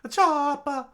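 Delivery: piercingly high cry of alarm; Kureha screaming. Acted. Doctor seeing Chopper hit by cannons, alarmed scream. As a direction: scream the name out. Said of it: "Kureha screamed out".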